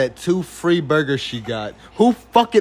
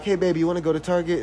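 quick cheerful ask; 6 semitones higher, 4 dB quieter, much faster than usual. Retold or imitated. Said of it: "I would be like".

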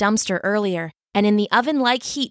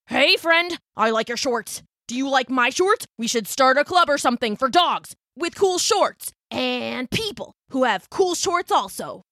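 nervous blurting; 4 semitones higher than usual. A narrator's reading. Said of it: "came out louder and faster than he meant it".

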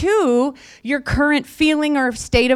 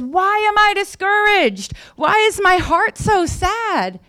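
anguished psalmist; 8 semitones higher than usual. Acted. Preacher voicing the psalmist questioning his own heart, high plea. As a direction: plead it high, slowing into the second question.

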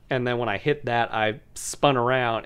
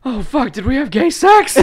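high, loud laughing shriek of alarm; hammed-up panicked yell through laughs; mock panic; 12 semitones higher, 10 dB louder than usual. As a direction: act out waking up horrified. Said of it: "I'm just like".

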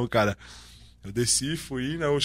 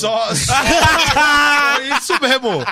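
laughing agreement; 6 semitones higher, 7 dB louder than usual. Real, not acted. Agrees with the Patrick joke.

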